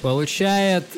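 loud, rising announcer voice; award announcer. Pretend. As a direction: announce the winner with fanfare.

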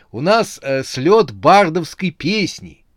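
hesitate, then announce it with nervous emphasis; faltering explanation. A narrator's reading. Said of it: "сбивчиво начал объяснять он". (сбивчиво оправдываясь)

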